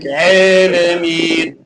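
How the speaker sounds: slow chanted "Alameen" with AA bent to EH; imala mistake. Shown as a demonstration. Teacher showing what cannot be said, imala mistake. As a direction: draw out "Alameen" as "eh-lemeen". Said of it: "We cannot say".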